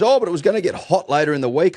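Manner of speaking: high, fast whiny excuse; mocking Matt Page. Mocking the curator's weather excuse, high and fast. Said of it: "he turns around and he goes".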